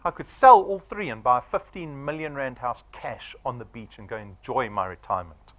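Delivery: usual speaking voice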